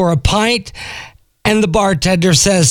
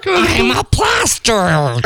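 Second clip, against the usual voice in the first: duck voice, shouted; 10 semitones higher than usual. Acted. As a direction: belt it like a cheeky duck. Duck voice in joke.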